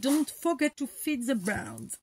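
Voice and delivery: slow English with a French accent; careful French-accented reading of an English phrase. Real, not acted. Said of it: "avec mon super accent".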